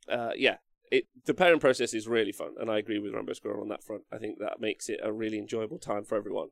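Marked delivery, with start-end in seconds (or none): none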